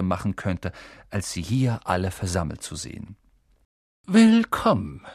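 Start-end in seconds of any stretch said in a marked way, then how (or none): 4.1-5.1 slow, high, loud welcome; old wizard calling. A narrator's reading. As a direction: call out a grand welcome. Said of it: "rief er". (langsamer, lauter Willkommensruf)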